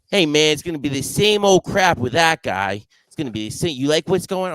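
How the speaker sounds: mock the friend's casual question; imitating Mike. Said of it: "He goes".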